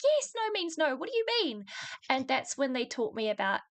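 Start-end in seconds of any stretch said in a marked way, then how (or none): 0.0-1.7 exasperated, much higher-pitched retort; high, incredulous comeback, imitating herself; retold indignant reply. Woman retelling her answer to doubting coworkers, indignant.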